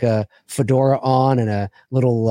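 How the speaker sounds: ordinary delivery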